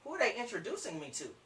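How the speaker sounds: hushed question to oneself; intrigued prospect. Acted. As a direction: wonder it very softly, suddenly attentive. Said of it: "They're gonna sit up straight".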